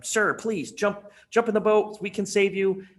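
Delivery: calling out from a boat. Acted. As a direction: plead with him to jump in, high and urgent. Coast Guard calling to stranded man.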